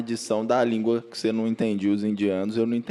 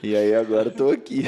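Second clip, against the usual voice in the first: laughing through the line, louder; a laugh at the punchline; 5 dB louder than usual. Real, not acted. Ending his Canada story to the hosts, laughing.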